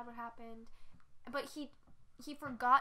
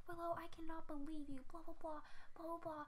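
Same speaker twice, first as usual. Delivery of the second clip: scolding mom; 5 semitones higher, 4 dB quieter than usual. Retold or imitated. Voices mom scolding her.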